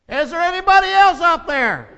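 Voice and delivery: loud, high yell up at the sky; panicked yell. Acted. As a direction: shout it up to heaven in desperation. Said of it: "the tourist yelled".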